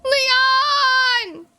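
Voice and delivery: high, loud shout for help; calling out. Acted. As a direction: yell his name.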